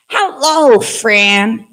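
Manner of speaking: lightly voiced cheerful ant; friendly ant voice. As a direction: greet a new friend warmly as the little ant. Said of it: "make different voices".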